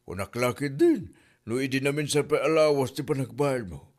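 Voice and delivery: usual speaking voice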